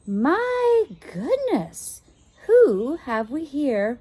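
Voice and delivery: high, slow, gentle governess; gentle lady. Acted. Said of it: "she asked gently".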